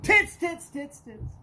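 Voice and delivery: high, loud outburst tailing off; imitated startled scream. Imitating a goosed old electrician blurting his thoughts, mock scream. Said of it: "goosed him and he screams out".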